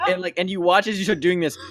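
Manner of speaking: ordinary talk, nothing marked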